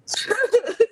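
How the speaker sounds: high, bitter burst of laughter. Real, not acted. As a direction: laugh at the absurdity. Laughs after the sad story.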